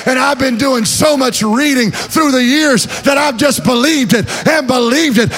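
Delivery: rising to a loud, high shouted sermon; preaching at full force. Acted. Preacher shouting faith to the congregation, full-force preaching. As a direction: preach it out to the whole church.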